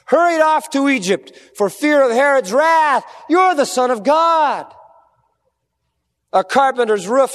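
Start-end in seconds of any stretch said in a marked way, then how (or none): 0.1-4.7 Satan shouting. Retold or imitated. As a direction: hurl it at him like a taunting devil. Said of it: "that voice of Satan".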